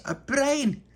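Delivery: very high "A brain?" in disbelief; high squeaky astonishment; shocked question. Acted. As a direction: gasp it out in disbelief.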